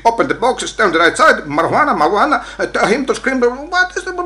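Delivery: high-pitched garbled English commands; mock police orders. Mimics police barking English orders. (παρωδία αστυνομικού, ψηλή φωνή)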